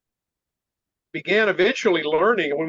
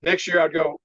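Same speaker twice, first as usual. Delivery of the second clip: ordinary talk, nothing marked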